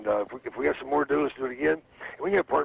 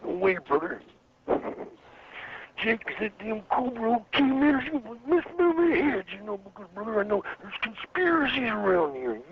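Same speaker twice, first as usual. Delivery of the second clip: higher, slow Macho Man promo voice; Randy Savage impression; 9 semitones higher, slower than usual. Shown as a demonstration. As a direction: drag out each word like a paranoid wrestler. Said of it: "Can you do your Randy Savage impression again".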